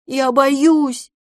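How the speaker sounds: high, loud fearful wail; whining kitten. A narrator's reading. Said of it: "ныл котёнок". (испуганное нытьё котёнка)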